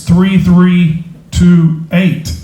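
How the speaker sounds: digits spaced out slowly; slow number call. Real, not acted. Raffle host calling a redrawn ticket number, slowly and deliberately. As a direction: announce it digit by digit, unhurried.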